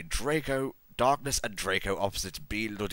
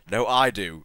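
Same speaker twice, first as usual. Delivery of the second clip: mild, louder shouted retort; 2 semitones higher, 7 dB louder than usual. A narrator's reading. Vampire arguing back at Draco, mild shout. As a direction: shout back with some restraint.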